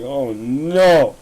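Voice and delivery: loud, high mock wail of dread; hammed-up anguished cry, rising high; nervous panic. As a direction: re-enact panicking, pitch climbing as it goes. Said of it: "getting nervous as fuck".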